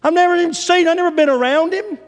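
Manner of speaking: loud, very high angry outburst; Peter ticked off, swearing. Acted. Peter tells accusers he doesn't know this man, shouting angrily. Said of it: "He cussed them and swearing to them".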